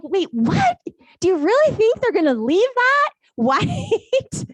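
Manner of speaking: high, slow disbelieving question with a laugh breaking in; can't believe they'd think that; incredulous disbelief. Retold or imitated. Agent answering the buyer's agent, high laughing disbelief.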